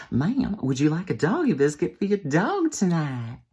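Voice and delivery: high, over-polite drive-thru server voice; hammed-up polite server; eager server. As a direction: offer it in a high customer-service lilt. Leans out and repeats offer.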